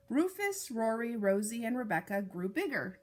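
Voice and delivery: ordinary delivery, nothing marked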